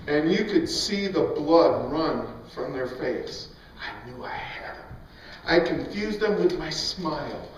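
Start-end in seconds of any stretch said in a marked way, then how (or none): 3.8-4.9 breathy whispered aside, barely voiced. A preacher confiding a private thought to his congregation, whispered. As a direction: let it out as a secret whisper.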